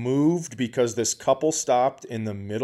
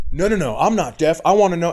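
raised, loud insistence; indignant retort; 5 semitones higher, 6 dB louder, faster than usual. Retold or imitated. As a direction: push back like an affronted husband. Husband arguing back, retold.

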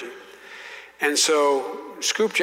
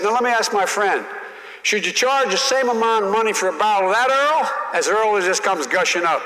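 higher, louder folksy senator explaining; impersonating a senator lecturing a rookie; Russell Long voice; 6 semitones higher, 4 dB louder than usual. Retold or imitated. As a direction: talk down like a wily old pol. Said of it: "and he went like this".

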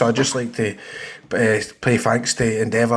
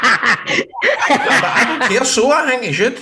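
laughing; 10 semitones higher, much slower than usual. Real, not acted.

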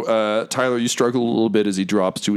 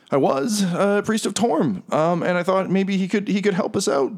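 faltering ex-priest, higher than the narration; halting plea of a man let down by his gods; confessing captive; 8 semitones higher than usual. Acted. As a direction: stumble through the confession. Game master plays confessing captive.

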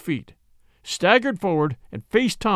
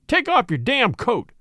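roared challenge; 7 semitones higher than usual. A narrator's reading. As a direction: roar it as a challenge to fight. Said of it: "he roared at last".

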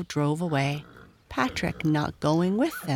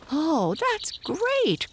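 high, drawn-out delight; excited cry; 13 semitones higher, much slower than usual. A narrator's reading. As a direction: exclaim like a thrilled child, slowly stretched. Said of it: "cried George".

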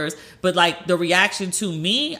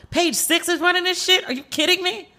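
high disbelieving squeal; are you kidding me, pitched way up; incredulous; 10 semitones higher than usual. Retold or imitated. Reacting to Page Six asking her for a quote, incredulous. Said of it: "I was like".

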